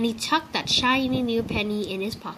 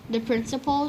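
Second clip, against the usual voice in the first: plain reading voice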